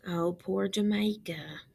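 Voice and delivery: soft whispered sympathy. Real, not acted. Teacher whispering pity for Jamaica to the class. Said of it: "sympathy aside whispered".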